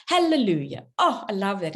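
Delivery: a gushing churchgoer, hammed up; mock evangelical gushing. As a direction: imitate an evangelical woman praising out loud.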